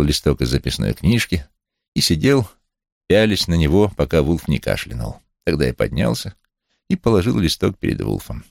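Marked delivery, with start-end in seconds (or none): none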